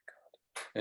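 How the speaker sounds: breathless whispered God, barely voiced; exasperated whisper. Real, not acted. Telling a friend about a maddening talk, exasperated whisper. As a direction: let it out as a spent whisper under the breath.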